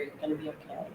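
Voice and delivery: very soft, imitating a whisper in the ear; retold whisper. Man whispering reassurance in her ear, retold whisper. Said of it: "he was just whispering in my ear".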